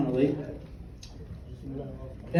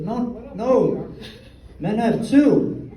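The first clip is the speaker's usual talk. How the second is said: lightly voiced insistent kid, higher pitched; little boy; 6 semitones higher, much slower than usual. Lil Johnny contradicts the health class teacher, as a little boy. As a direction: correct the teacher like a kid, slowing at the end. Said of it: "except for Lil Johnny".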